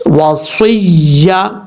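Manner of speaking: وصیہ recited very slowly with the صاد whistled between the teeth; recitation demo. Shown as a demonstration. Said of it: "اس طریقے سے". (وصیہ کو سیٹی والی صاد کے ساتھ آہستہ پڑھیں)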